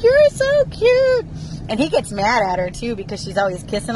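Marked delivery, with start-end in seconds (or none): 0.0-1.3 high, loud, drawn-out cooing; gushing daughter. Retold or imitated. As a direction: imitate a daughter gushing, very slowly. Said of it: "She's always like".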